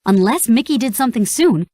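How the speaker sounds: ordinary delivery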